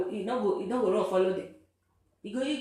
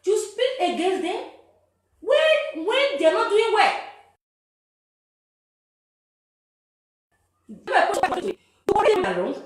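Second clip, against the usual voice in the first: raised, higher preaching voice; 6 semitones higher, 5 dB louder than usual. Real, not acted. Man lecturing activists on their duty, raised preaching voice. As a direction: lecture them with rising force.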